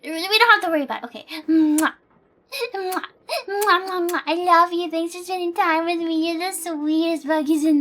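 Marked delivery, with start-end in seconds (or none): 1.5-4.2 kiss sounds. Real, not acted. Blowing kisses to chat.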